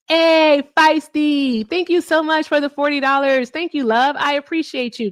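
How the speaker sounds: high, loud gushing thank-you; excited thanks. Real, not acted. Streamer thanks Feisty for forty dollars, excited. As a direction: thrill at the donation and let it show.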